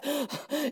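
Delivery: acted gasp for air; out-of-breath gasp. Shown as a demonstration. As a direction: gasp as if winded. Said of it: "Out of breath".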